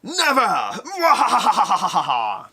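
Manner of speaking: loud, high, slow evil cackle; evil villain laugh. Retold or imitated. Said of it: "I'm gonna do the laugh".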